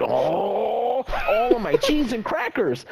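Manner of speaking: drawn-out "Oh", high-pitched fake cursing; mock mad outburst. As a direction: imitate a coworker's wholesome rage. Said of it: "Lizard Boy over here gets mad".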